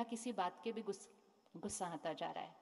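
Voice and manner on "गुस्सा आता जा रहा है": ordinary talk, nothing marked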